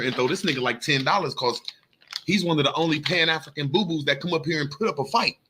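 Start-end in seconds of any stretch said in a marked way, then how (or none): none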